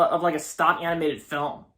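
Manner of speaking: normal talking voice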